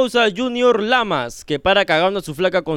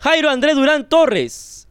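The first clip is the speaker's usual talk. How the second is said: slow, higher announcer call; donor announcement; 5 semitones higher, slower than usual. Real, not acted. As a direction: present the name like an MC. Anuncia el nombre de un donante. (anuncio de locutor, lento y agudo)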